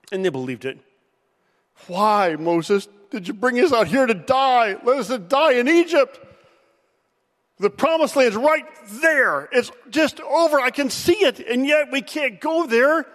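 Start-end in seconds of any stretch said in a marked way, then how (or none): 1.9-13.0 higher, wailing complaint of scared people; whining Israelites. Retold or imitated. Israelites whining to Moses about the giants, in a high wailing complaint. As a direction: whine it like a crowd begging to go back. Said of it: "They cried".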